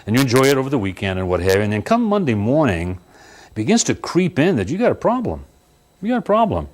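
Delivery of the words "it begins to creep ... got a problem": rising alarm. Acted. New homeowner realising trouble, high rising alarm. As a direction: voice climbing high on got a problem. Building dread about house.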